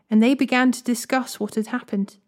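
ordinary delivery, nothing marked

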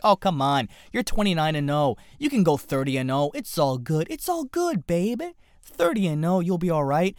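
wheedling, high-pitched coaxing. Retold or imitated. Promoter begging retired champion to return, imitated wheedling. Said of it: "trying to bring him back and be like".

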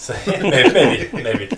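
loud, high laugh through the words. Real, not acted. Golfer admitting his own past mishaps to friends, laughing. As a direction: laugh helplessly while repeating the word.

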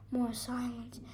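plain reading voice

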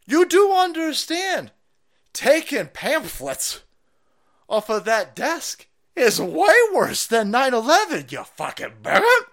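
high, loud, slow sarcastic alarm; mock outrage. Sneering at the news clip's claim, high mock outrage. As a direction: overplay the horror, mocking.

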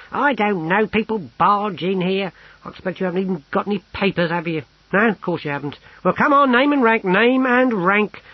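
high-pitched officious clerk, exasperated; harassed little clerk, impatient. Acted. Harassed clerk demands name and rank, impatient. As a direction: rant high, building to a loud demand.

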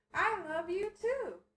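toy voice. Retold or imitated. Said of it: "this Stitch kept saying".

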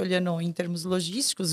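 ordinary talk, nothing marked

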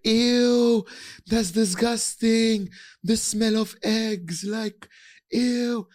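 high, loud mock-disgusted kids; disgusted classmates. [name] mocking classmates grossed out by his egg lunch, high squealing disgust. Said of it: "everyone used to be like".

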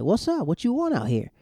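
high, pointed challenge; Luke confronting. Retold or imitated. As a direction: push back at the rival with an edge. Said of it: "Luke's like".

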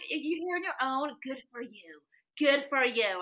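warmly impressed, good for you; encouraging praise. Retold or imitated. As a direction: stranger praising her solo trip. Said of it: "And then he was like".

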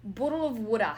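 showing off an Australian accent; put-on Australian "Bottle of water". Shown as a demonstration. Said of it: "bottle of water in an Australian accent".

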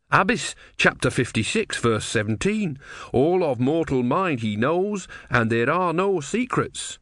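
high, measured scripture recital; earnest disciple reciting. Acted.

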